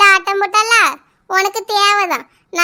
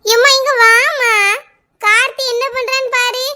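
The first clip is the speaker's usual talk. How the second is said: calling out; 4 semitones higher than usual. Acted. Daughter calling her mother to tell on her brother. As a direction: yell for mum to come and see. Girl calls mother to tattle.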